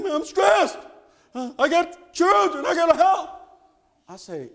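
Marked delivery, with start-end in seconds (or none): 0.0-3.3 higher, louder anxious complaint; stressed friend. Retold or imitated. Friend who lost his job telling Jeter his fears, stressed and anxious. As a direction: sound the worry of losing everything. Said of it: "he was so upset".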